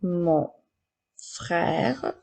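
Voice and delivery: word by word with pauses; careful dictation for pupils to write. Shown as a demonstration. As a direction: dictate slowly, each word set apart. Teacher repeating dictated words.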